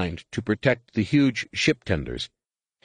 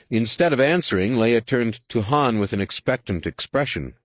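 ordinary delivery, nothing marked

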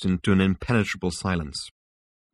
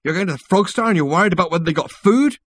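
loud, high-pitched disbelief; scoffing, rising at the end; incredulous; 11 semitones higher, 6 dB louder than usual. A narrator's reading. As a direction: throw it back as an astonished question. Incredulous retort, louder and higher.

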